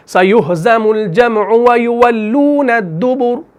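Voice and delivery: slow, drawn-out tajweed recitation; Quran recitation. Shown as a demonstration. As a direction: chant the verse slowly. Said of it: "तो वहां पर है दुबुरा".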